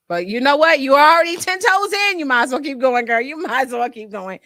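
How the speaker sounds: loud, high self pep talk; loud pep talk to herself to keep going. Retold or imitated. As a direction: hype yourself up, loud.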